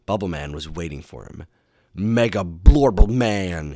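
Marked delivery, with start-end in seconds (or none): 1.9-3.7 loud, drawn-out underwater villain cry; big slow villain greeting. A narrator's reading. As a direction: call it out slowly through bubbles. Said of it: "he cried".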